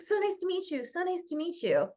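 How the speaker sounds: light Minnesota accent slipping in; a touch of Minnesota Midwestern vowels; soft Minnesota-nice greeting. Real, not acted. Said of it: "can you hear my Minnesota accent come out right now".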